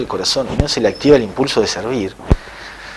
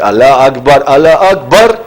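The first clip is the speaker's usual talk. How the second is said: loud muezzin's call, rising in pitch; imitated call to prayer; 3 semitones higher, 8 dB louder, slower than usual. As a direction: proclaim the adhan at full voice, building upward. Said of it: "empezó a hacer el llamado de la oración". (llamado a la oración imitado, fuerte y ascendente)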